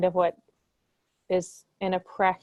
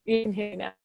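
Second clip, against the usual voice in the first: bad connection. Real, not acted. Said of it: "you sound a little bit choppy now".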